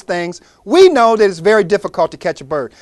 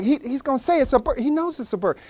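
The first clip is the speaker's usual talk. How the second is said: higher, much softer side comment; a softer, high kid's aside; hushed aside; 6 semitones higher, 9 dB quieter than usual. Acted. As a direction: keep it secret from the old man. Kids whispering beside the man.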